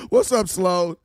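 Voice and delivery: ordinary talk, nothing marked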